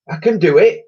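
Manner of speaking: loud, high irritated snap; snapped. Acted. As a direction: brush off the help sharply. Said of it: "he snapped".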